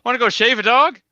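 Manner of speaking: high, loud goofy voice; hammed-up high-pitched question; comic impression. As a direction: pitch it way up, pushed and silly. Acting out Tom Green bit.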